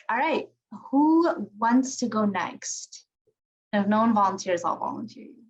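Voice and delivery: ordinary talk, nothing marked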